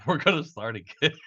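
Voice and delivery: cracking up while talking; laughing. Real, not acted. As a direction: let the sentence dissolve into real laughter. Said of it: "I'm trying to laugh it off".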